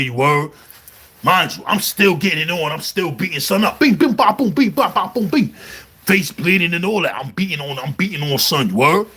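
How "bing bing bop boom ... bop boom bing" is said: pepper it like a flurry of blows; punch sound effects. Retold or imitated. Reliving beating the rival in jail, punch noises.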